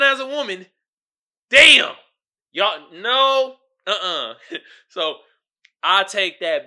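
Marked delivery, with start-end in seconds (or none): none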